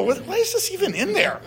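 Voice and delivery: high, thin baffled question, pulled back; mock a confused Bible reader; puzzled reader. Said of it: "that we read and go".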